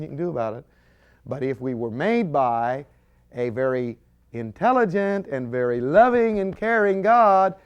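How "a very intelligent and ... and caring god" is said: rising louder to God; swelling preacher climax; building emphasis. Acted.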